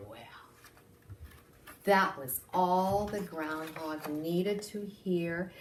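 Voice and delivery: ordinary delivery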